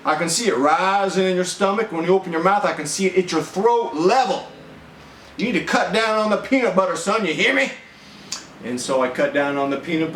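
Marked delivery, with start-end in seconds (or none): 0.1-7.7 much higher, fast mock-stern scolding; imitating military father. Father scolding his son for eating peanut butter, mock-stern and quick. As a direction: scold like an officer inspecting a recruit. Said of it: "He goes".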